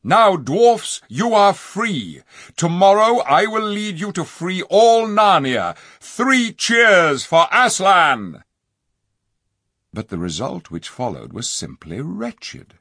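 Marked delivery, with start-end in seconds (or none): 0.0-8.4 high, loud, slow triumphant king; rousing king. A narrator's reading. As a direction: proclaim victory to the crowd. King rallies freed dwarfs.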